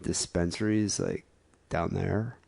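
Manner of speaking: low, halting stoner impression; mock a spaced-out Californian hunting for the next word; slow stoned Californian. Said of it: "'Cause they're slow".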